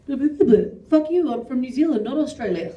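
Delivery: mock bar squabble. New Zealander squabbling in background of bar scene, mock-indignant. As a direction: pick a pretend fight, loudly.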